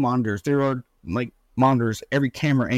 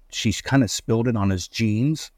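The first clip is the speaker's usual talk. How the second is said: ordinary talk, nothing marked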